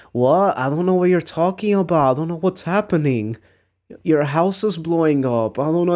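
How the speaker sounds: feigning innocence while wrecking their house; mock innocent. Typing fake innocence while griefing.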